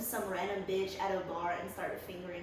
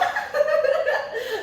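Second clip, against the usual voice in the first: shrieking laughter; high cackle; 8 dB louder than usual. Real, not acted. Laughing at own joke.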